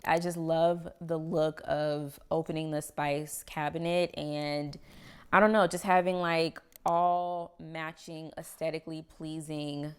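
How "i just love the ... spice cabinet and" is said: ordinary delivery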